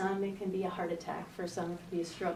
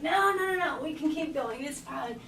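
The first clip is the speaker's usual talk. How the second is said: higher, insistent denial; insistent brush-off; 7 semitones higher than usual. Retold or imitated. To her worried client on the beach, a higher insistent brush-off. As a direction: wave it away, pitched up and eager. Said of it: "And I'm like".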